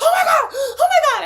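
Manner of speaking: high, loud imitation of a frantic wail; mimicked hysterical screaming; crying child. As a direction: shriek it like a panicked daughter. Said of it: "She was just crying".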